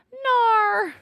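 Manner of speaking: loud high frustrated "Nar!" yell; frustrated growl shout. Real, not acted. Venting at technical difficulties, a loud high frustrated yell. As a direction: blurt the annoyance out loud.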